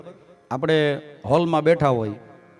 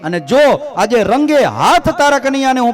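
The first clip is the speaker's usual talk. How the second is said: loud, high calls getting ever louder; gopi shouting; 7 semitones higher, 7 dB louder than usual. Acted. Gopi shouts at Yashoda's door, building to a loud high scream. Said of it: "જોર જોરથી બૂમો નાખે કે".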